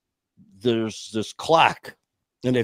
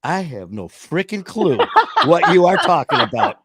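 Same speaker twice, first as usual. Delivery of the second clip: laughing disbelief; 6 semitones higher, 6 dB louder than usual. Retold or imitated. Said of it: "I go".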